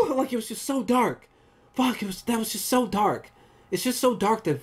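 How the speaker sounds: high, shaken-up reaction; appalled. Real, not acted. Reviewer reacting to how dark the scene was, appalled.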